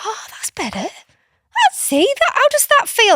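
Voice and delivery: high, bright-toned praise rising to loud; lavish praise to reward calm asking; encouraging praise. Acted. Aunt praising niece's calm request, high encouraging voice. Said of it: "I'm like".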